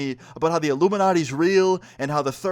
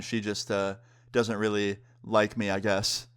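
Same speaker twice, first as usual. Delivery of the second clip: low, quiet, deflated mumble; downcast admission; 5 semitones lower, 4 dB quieter than usual. Retold or imitated. Telling the waiter why his date left, downcast and quiet. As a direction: admit it reluctantly, voice sinking.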